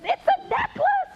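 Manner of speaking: very high, slow squeal of excitement; excited little girl. Retold or imitated. Susie tells her teacher what it is, excited squeal.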